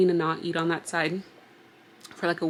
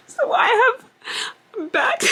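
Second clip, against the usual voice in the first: voice breaking; 5 dB louder than usual. Real, not acted. Voice cracking near tears.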